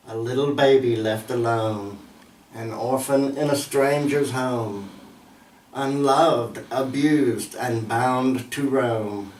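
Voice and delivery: measured poetry reading, pausing after each line; poem recitation. Acted. Recites own poem on suffering children.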